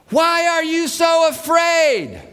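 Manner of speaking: slow, high, loud yell; loud question. Retold or imitated. Jesus yelling his question over the wind, loud. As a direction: bellow the question over the storm. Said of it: "He's having to yell".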